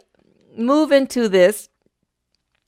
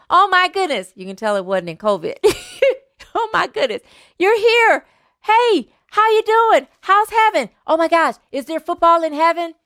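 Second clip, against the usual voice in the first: overjoyed surprise; 7 semitones higher than usual. Acted. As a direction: gush with delight at the ghost. Friend amazed at ghost's return.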